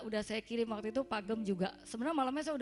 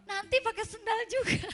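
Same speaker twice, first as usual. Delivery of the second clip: high-pitched, louder mock complaint; retold retort; 11 semitones higher, 5 dB louder than usual. Daughter answers mother's advice about shoes, high protesting retort. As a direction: throw back a high, loud objection.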